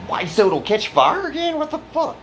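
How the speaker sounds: squeaky high, disbelieving outburst; can't believe what they're asking; incredulous. Real, not acted. Outrage at staying in same room.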